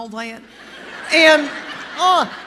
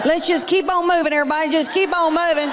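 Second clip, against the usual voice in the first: mock brisk shooing; 6 semitones higher than usual. Stand-up, urging crowd past awkward moment.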